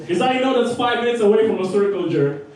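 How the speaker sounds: ordinary talk, nothing marked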